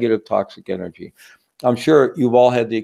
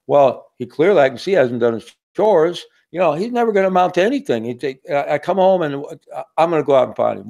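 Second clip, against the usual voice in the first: a hint of a grumbling father, higher and fast; annoyed dad; 5 semitones higher than usual. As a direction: grumble that the kid will never amount to anything. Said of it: "And George".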